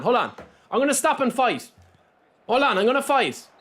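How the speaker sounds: ordinary talk, nothing marked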